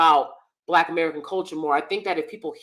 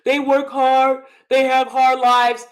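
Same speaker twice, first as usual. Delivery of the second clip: mocking the panelist; 5 semitones higher, 6 dB louder than usual. Mocking a panel guy's excuse for viewers, whiny imitation. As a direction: mimic him as dismissive and whiny. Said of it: "this guy on the panel was like".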